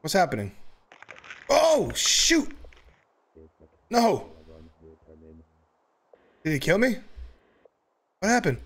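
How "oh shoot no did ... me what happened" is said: startled shock. Real, not acted. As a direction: caught off guard, yelp. Killed by monster.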